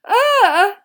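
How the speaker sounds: high, loud "ugh"; disgusted squeal. A narrator's reading. Lavender repelled by the creatures, squealing. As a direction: groan high with revulsion. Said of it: "said La-, Lavender Brown again".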